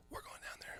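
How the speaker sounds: hushed, breathless whisper. Retold or imitated. Telling his friends in the car, whispered. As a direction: whisper the plan like a secret.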